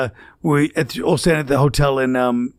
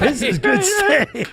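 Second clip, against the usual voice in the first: very high, slow, laughing cartoon voice; drawn-out silly cartoon mimic; mock Norm eating; 13 semitones higher, much slower than usual. Comics recalling Norm eating steak, high cartoon mimic.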